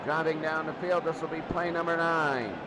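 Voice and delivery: usual speaking voice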